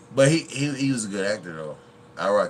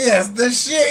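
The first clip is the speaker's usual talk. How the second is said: movie line impression; 11 dB louder than usual. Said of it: "He said".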